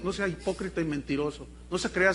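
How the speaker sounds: usual speaking voice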